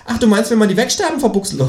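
high, rapid marsupial chatter; fast cartoon kangaroo. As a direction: rattle it off high as a pushy kangaroo. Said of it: "fragt das Känguru".

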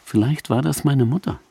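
usual speaking voice

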